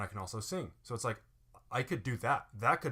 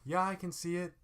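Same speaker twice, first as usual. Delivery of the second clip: put-on high, impatient kid whine; be an irritating brat; pitched-up petulant voice; annoying tone; 8 semitones higher than usual. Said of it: "what an annoying, like, tone of voice".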